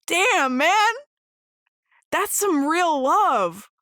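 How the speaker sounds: high, loud burst of awe, slowing at the end; moved outburst. Retold or imitated. Watching Iroh hug Zuko, a loud, high, awed outburst. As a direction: blurt it out, overwhelmed by the love. Voicing his reaction to the hug.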